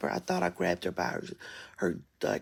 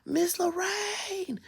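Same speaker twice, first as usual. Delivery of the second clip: mock scream; 11 semitones higher, much slower than usual. Screaming for the woman in the snow, mock scream. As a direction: stretch the name out in a restrained scream. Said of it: "I start screaming".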